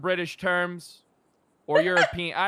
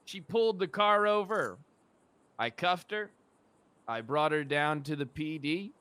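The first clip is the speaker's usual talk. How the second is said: ordinary delivery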